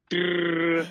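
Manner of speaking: loud mock angry growl. Ejected batter fuming at the umpire with a growl. Fuming after throwing his helmet.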